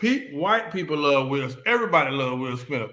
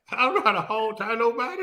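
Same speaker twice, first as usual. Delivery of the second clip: laughing quote; 6 semitones higher than usual. Retold or imitated. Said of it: "quoting the line".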